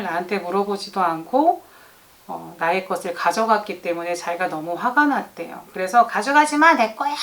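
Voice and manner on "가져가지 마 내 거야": 높고 크게 외치는 아이 흉내; 뺏기기 싫어 소리치는 아이처럼. Retold or imitated. Child grabbing back his toy. (동생에게 소리치는 아이를 흉내 낸 높은 외침)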